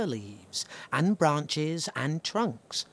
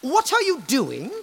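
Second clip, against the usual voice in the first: higher, louder haughty tree voice; vain tree; 11 semitones higher, 4 dB louder than usual. Acted. The tree refusing animals, haughty and high. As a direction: sniff it out haughtily. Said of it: "said the tree".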